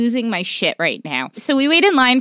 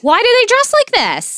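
exasperated; 12 semitones higher, 3 dB louder than usual. Real, not acted. Exasperated rant about TikTok.

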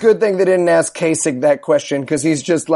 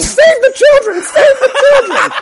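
mock screaming; 5 dB louder than usual. Moderator mocks Walker screaming a frantic slogan. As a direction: shriek it like a frantic zealot.

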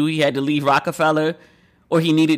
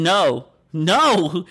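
drawn-out appalled cries of no; mock outrage from the background; outraged heckling; much slower than usual. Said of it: "he sounds outraged".

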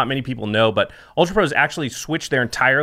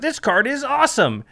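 excited; 11 semitones higher than usual. Retold or imitated. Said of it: "and you're like".

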